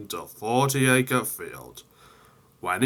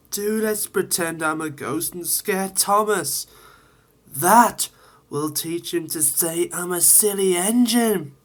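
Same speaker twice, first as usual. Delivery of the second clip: higher, mischievous eager voice; Percy scheming; 8 semitones higher than usual. Acted. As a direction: plot the prank gleefully. Percy plans the prank.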